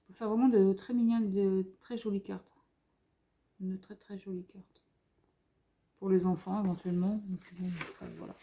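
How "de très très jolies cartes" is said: soft breathy whisper, no voice. Real, not acted. Vlogger showing paper pad, whispering to viewers. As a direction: drop to a hushed whisper on très jolies cartes. Repeats phrase, second time whispered.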